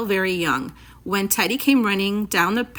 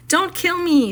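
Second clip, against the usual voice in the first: high, pleading near tears; almost crying; 10 semitones higher, 4 dB louder than usual. Acted. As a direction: beg for your life, voice close to breaking. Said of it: "almost crying".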